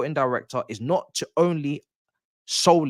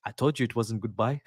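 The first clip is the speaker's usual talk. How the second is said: loose put-on foreign accent; softer, jokey imitation of a footballer's accent; Thiago Silva accent; 5 dB quieter than usual. Imagining the returning player announcing himself, in a put-on accent. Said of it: "don't know why I'm doing that accent".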